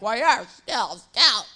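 imitating dad. Voicing her dad in story.

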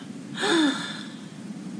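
high, breathless gasp of shock; sharp gasp. Retold or imitated. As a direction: gasp as if something awful just happened. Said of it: "I started weeping and wailing".

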